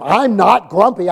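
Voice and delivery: loud, slow, exasperated insistence; grumpy denial. Retold or imitated. Pastor answering teenage girl for the third time, grumpy denial. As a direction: deny it forcefully, stretching each word. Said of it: "by the third time".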